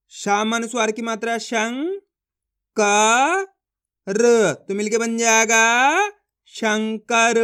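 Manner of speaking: rising शंग, loud drawn-out का, र, then blended शंकर; slow spelling chant with pauses, building to शंकर; letter by letter. Shown as a demonstration. Teacher asks learners to copy, slow stretched letters into शंकर. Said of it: "मैं किस तरीके से एक एक अक्षर का उच्चारण कर रहा हूँ".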